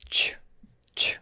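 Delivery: 추 said softly, almost whispered; 추 as the tr sound in interest; chu sound. Shown as a demonstration. Teacher isolating the tr sound as 추. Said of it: "이 소리가 나요".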